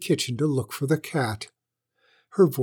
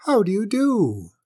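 high, sweet little greeting; polite kitten; 8 semitones higher, 3 dB louder than usual. A narrator's reading. Little kitten greeting the old witch, politely. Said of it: "said politely".

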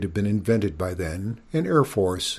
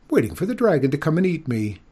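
high, quick princess answer; indignant princess; 7 semitones higher, 4 dB louder, faster than usual. A narrator's reading. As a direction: rattle it off higher, as if obvious.

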